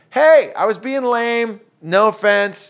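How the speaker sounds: slow, high, loud retold call; mom calling out. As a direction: imitate a mom hollering through the house. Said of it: "hearing their mom say".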